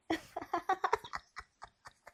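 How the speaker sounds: high run of giggles; real high-pitched laughter. Real, not acted. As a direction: crack up at your own bad accent. Laughing at own English accent.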